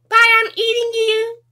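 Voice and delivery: squeaky high biting pet voice; high creature voice. Pretend. Pet voice threatens again.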